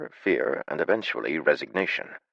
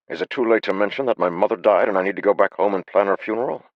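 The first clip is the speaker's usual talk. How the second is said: desperate; 6 semitones higher, 6 dB louder than usual. Acted. Haber's desperate excuse.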